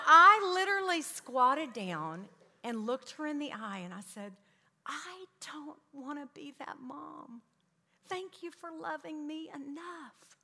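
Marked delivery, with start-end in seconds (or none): 4.9-10.4 soft, slow, heartfelt retold apology; hushed, humbled mother apologizing; tender apology. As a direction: say it gently and slowly to your little girl. Mother's tender apology to her daughter.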